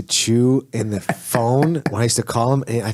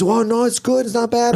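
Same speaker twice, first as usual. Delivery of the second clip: high voiced coach reassuring him; light impression of the Brazilian coach; 9 semitones higher than usual. Coach telling the burning student it's fine, high voice.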